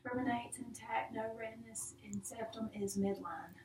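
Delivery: whispering. Real, not acted. Quiet aside noting exam findings.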